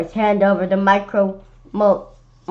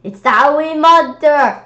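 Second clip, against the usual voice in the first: loud, higher alarmed yell; frightened cry; 7 semitones higher, 9 dB louder than usual. Acted. Kids warning each other about the monster, loud alarm.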